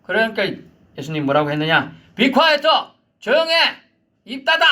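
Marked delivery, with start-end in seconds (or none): 2.1-4.6 high, loud angry shout; shouted rebuke. Acted. Jesus shouting the demon down in anger. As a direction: snap the order at the demon. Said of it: "지금 이렇게 소리치고".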